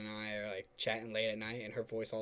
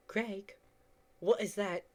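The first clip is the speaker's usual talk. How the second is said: put-on higher-pitched British girl; British accent; 9 semitones higher than usual. Reading the girl's line to a friend, British accent. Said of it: "Why are you turning her British?".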